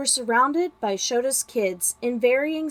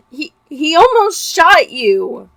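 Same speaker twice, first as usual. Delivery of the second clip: louder, higher, slow sobbing cry; 6 semitones higher, 11 dB louder, slower than usual. Acted. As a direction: stammer it out through sobs. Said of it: "Izuku was sobbing".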